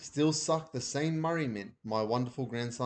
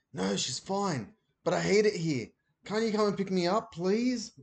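whispered; 7 semitones higher than usual. A narrator's reading. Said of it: "whispered Ben".

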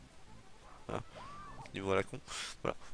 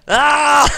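sharp loud high yelp; a sudden shriek of shock; startled scream; 22 dB louder than usual. Real, not acted. Character dies suddenly.

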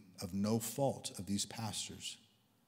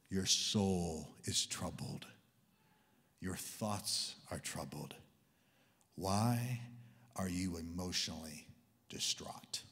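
very slow, low, hushed delivery; gentle probing; 5 semitones lower, 3 dB quieter, slower than usual. Retold or imitated. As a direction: speak tenderly into a troubled soul. Jesus tenderly probing the troubled soul.

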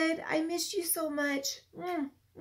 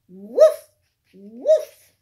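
lower, loud woofs; low dog barks; 7 semitones lower, 14 dB louder than usual. Acted. Teacher barking for Biscuit, low and loud. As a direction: bark deep and loud like a bigger dog.

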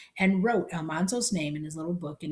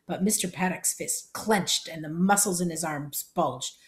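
ordinary delivery

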